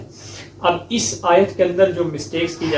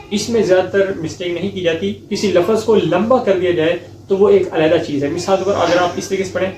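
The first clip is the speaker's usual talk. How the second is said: usual speaking voice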